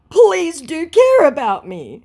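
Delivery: high, loud, drawn-out mock plea; slow theatrical begging; pleading scammer. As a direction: beg in a hammed-up, high-pitched voice. Voices scammer's pleading message.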